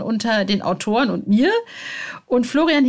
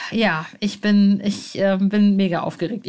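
ordinary talk, nothing marked

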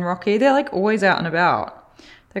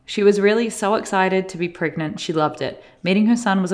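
normal talking voice

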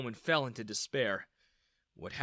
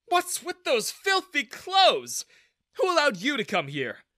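disgusted; 12 semitones higher, 8 dB louder than usual. A narrator's reading. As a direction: sneer down at the beggar. Manager scorns ragged customer.

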